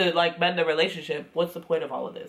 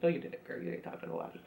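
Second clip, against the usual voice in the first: sassy whisper; 13 semitones lower, 12 dB quieter, much faster than usual. Retold or imitated. Quiet sassy retort, whispered.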